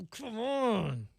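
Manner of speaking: slow, soft groan of disbelief; mock exasperation. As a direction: draw it out like a let-down audience member. Acting out audience at terrible jokes.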